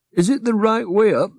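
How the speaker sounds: drowsy bat correcting them gently; a high, dreamy little bat; sleepy bat. Acted.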